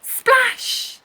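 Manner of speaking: high bright burst; splashy exclamation. A narrator's reading. The wave splashing Anna, high excited burst. Waves splash, sound word.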